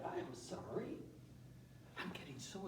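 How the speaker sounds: whispered sorry to the side; whispered aside. Real, not acted. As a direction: slip it in under your breath. Aside apology to assistant.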